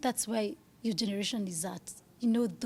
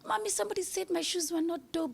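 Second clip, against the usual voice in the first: put-on high-pitched little kid telling mommy; imitating her daughter; 8 semitones higher than usual. Mother quoting her daughter's complaint about shoes, high childlike imitation. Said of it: "You know, she'll say, like".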